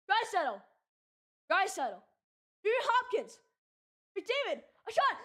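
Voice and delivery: rapid, rising hockey call; play-by-play commentary. Acted. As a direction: call the rush like a TV announcer.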